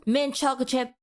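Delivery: "mint chocolate chip" rattled off in one go; quick and easy. Shown as a demonstration. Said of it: "you said that so freaking quickly".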